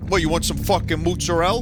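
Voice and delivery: New York Italian. Acted. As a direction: put on a pushy New York Italian accent. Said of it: "born and raised in New York and I'm Italian".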